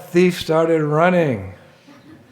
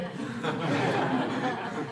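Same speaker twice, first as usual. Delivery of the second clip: soft laugh; 11 semitones higher, 11 dB quieter than usual. Real, not acted. Laughing at the story.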